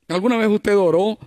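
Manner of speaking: usual speaking voice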